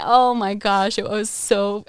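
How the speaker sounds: usual speaking voice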